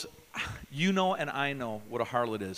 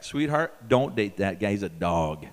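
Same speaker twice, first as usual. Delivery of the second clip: lower, firm fatherly warning; 7 semitones lower, 4 dB louder than usual. Pretend. Pastor warns a girl about her date, low and firm. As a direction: lean in and warn her off. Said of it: "I'll call you back".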